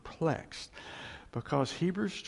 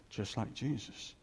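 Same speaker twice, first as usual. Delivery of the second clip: hushed; 5 semitones lower, 10 dB quieter than usual. Real, not acted. Repeated softly.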